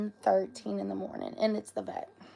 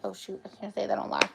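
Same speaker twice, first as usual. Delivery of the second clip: normal talking voice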